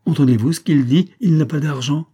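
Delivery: high, loud, outraged mockery; scornful indignation. A narrator's reading. Émilie menacing the lieutenant over his excuse, scornful indignation. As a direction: throw his excuse back at him loudly.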